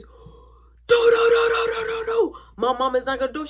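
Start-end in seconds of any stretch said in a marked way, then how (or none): 0.0-2.4 loud, high rapid-fire do-do-do; high-pitched burst of mock noise; imitated commotion. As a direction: imitate a sudden racket from the next room. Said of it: "all you hear is".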